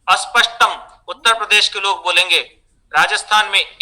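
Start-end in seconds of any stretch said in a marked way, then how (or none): 0.1-0.9 स्पष्टम said as aspashtam; as for initial s. Shown as a demonstration. Teacher showing Uttar Pradesh fault, extra 'a' in front. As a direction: put an 'a' before the s, अस्पष्टम. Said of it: "कोई इसे अस".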